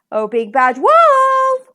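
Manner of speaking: high, loud, slow call; long, emotional duck cry. A narrator's reading. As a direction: call after him, stretching the last word. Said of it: "cried Missus Duck".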